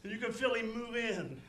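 impassioned pulpit delivery with loud bursts; emphatic, heartfelt revival preaching; fervent preaching. Acted. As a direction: preach it with conviction to the saints. Preaching with rising fervour.

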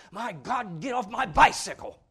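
a hint of a bossy little boy; child's playground shout. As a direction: boss another kid off your bike. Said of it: "little Junior ... tell other little Junior".

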